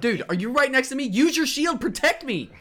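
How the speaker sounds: high, loud urgent pleading; high loud panicked plea; frantic calling. Acted. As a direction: yell for help from your ally. Character pleads with ally in fight.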